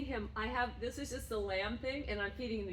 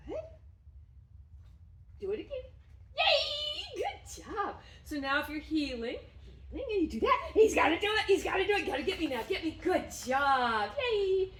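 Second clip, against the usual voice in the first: bursting, high, loud praise for the dog; squealing delighted dog praise; excited praise; 8 semitones higher, 5 dB louder than usual. Real, not acted. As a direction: cheer him on like he just won.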